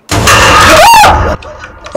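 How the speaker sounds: sharp, high shocked gasp-shriek; a sudden fright grabs the breath; startled gasp. Real, not acted. Startled by something in game.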